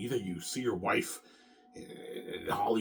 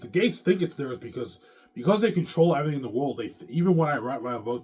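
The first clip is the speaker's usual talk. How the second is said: angry rant; 5 semitones higher, 8 dB louder than usual. Real, not acted. Ranting about the Gates.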